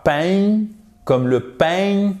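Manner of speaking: "pain" with a French nasal ending, like French bread; imitated French-accent mistake on "pain", held up high. Shown as a demonstration. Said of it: "quand j'imite l'accent français".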